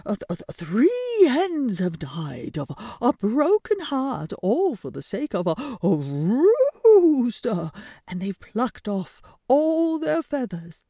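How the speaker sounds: loud flustered rooster; stammering rooster. Acted. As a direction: blurt it out half awake.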